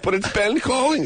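high-pitched, panicky imitation; mock panic. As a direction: act out a flustered driver, for laughs. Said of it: "he's in a panic".

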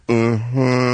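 drawn-out, knowing confirmation; knowing mm-hmm. Retold or imitated. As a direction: imitate a pointed, knowing hum. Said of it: "like that".

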